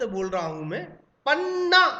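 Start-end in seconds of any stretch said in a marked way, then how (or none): 1.3-1.9 Panna punched high and loud on the पा; पन्ना as a loud, high stress demo, weight on पा. Shown as a demonstration. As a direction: hit पा hard in Panna, then hold out the ना. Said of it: "यहां ज्यादा बल किस पर देना पड़ा?".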